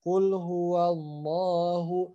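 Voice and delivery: slow, drawn-out recitation on a held tone; Quran recitation demo. Shown as a demonstration. Teacher models the verse for students, slow clear recitation. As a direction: recite it slowly, every syllable held long. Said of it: "Tapi di sini harus jelas".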